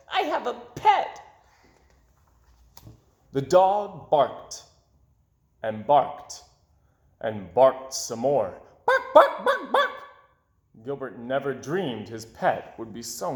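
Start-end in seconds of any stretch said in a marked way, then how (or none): 0.1-1.2 high, bubbly little fish voice; happy goldfish voice. Acted. Goldfish Gilbert delighted with his new dog. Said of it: "Gilbert glubbed". 8.9-9.9 high, loud yappy barks; dog barking. Acted. Narrator barks as the noisy dog.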